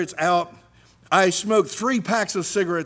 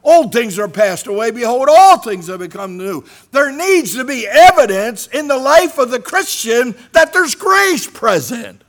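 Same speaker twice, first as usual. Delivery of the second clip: loud, high preaching of scripture; full-force preaching; 8 semitones higher, 10 dB louder than usual. Acted. Preacher proclaiming scripture to the church, loud and high. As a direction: proclaim it to the congregation.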